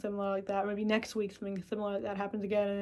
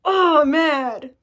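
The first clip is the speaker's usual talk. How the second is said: higher, louder, slow acknowledged anger; honest anger; 7 semitones higher, 12 dB louder, much slower than usual. Acted. Honest self-talk admitting anger, slow and loud. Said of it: "you're gonna be like".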